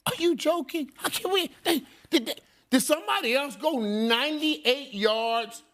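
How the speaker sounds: can't believe the question; incredulous. Real, not acted.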